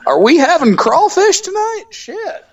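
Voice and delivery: a high caricature of a mom asking about dinner; mock Southern mom. Mocking a Southern household, in a high mock voice.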